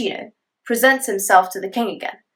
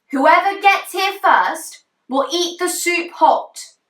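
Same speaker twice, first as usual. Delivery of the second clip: mother calling out; 9 semitones higher, 3 dB louder, slower than usual. Acted. Mother calling sons to hurry.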